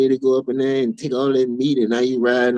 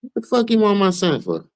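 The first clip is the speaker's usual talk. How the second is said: high-pitched, hammed-up indignant parent; mock outraged parent; 12 semitones higher than usual. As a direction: demand an answer as a protective parent, pitched way up. Acts out parent answering the summons.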